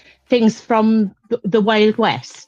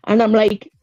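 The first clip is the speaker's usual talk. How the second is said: usual speaking voice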